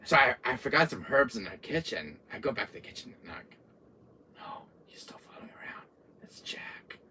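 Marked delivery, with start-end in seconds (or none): 4.4-7.0 hushed, acted dread; very soft aside while hiding; hiding whisper. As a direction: whisper it so he can't hear you. Player hiding, talking to self.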